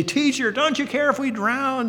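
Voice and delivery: high, frantic cry of scared disciples; panicked plea. Retold or imitated. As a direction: plead as if the boat is sinking. Panicked disciples wake Jesus.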